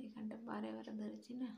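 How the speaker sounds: slow whisper, swollen lip. Real, not acted. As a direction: whisper past a puffy lip. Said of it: "তো কথা বলতে একটু অসুবিধা হচ্ছে এখানটায়".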